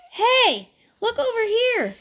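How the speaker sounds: high, loud call across. A narrator's reading. Boy on the trunk calls his friends, high and loud. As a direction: call the others over. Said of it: "called Buddy".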